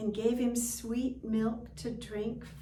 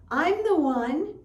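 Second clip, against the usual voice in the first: eager cat; 8 semitones higher, 11 dB louder than usual. Acted. Spotted cat claims him.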